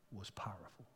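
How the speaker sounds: a reverent whispered aside; hushed whisper. Real, not acted. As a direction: let it fall to a whisper. Hushed climax of the sermon.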